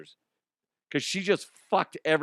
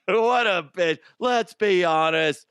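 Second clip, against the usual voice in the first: higher and louder, drawn out; loud emphatic verdict; 4 semitones higher, 5 dB louder than usual. Real, not acted. Host doubling down on the scooter lady, loud emphatic verdict. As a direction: proclaim it for everyone to hear.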